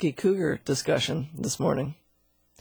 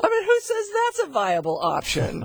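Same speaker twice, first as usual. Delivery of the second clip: very high, exasperated disbelief; incredulous; 14 semitones higher than usual. Real, not acted. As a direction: squeak out total disbelief. Incredulous at vaccination plan.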